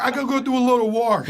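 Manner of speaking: put-on Long Island accent with an R added in wash. Quoting Long Island people who add R's, Long Island accent. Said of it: "places like Long Island where people go".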